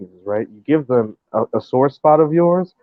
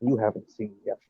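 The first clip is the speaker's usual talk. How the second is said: hushed ominous quote; 4 semitones lower, 12 dB quieter than usual. Retold or imitated. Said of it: "You hear Shar go".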